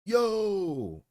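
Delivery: high shouted yo, yo; mock shout. Roommate bursting in upset, shouting. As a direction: yell it out, worked up. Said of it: "He was just shouting".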